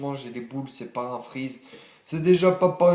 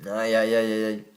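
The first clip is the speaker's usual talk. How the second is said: mock despair; 3 semitones lower, 4 dB louder than usual. Real, not acted. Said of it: "en train de péter un câble".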